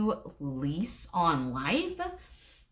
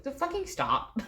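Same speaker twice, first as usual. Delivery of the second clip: normal talking voice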